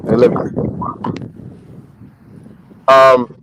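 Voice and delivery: normal talking voice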